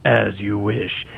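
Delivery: low, slow bounty hunter rasp; gravelly voice, a touch of menace; cool gravelly voice. Retold or imitated. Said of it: "that cool gravelly voice".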